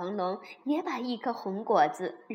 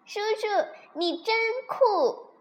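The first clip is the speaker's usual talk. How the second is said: overjoyed; 9 semitones higher, 5 dB louder than usual. A narrator's reading. Baby dinosaur admiring uncle after rescue, overjoyed. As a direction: gush as a thrilled little dinosaur, very high. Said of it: "高兴极了".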